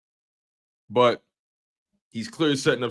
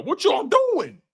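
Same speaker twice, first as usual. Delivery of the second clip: exasperated shout; slower than usual. Real, not acted. Streamer ranting at Cowboys fans, exasperated high shout. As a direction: yell it in disbelief.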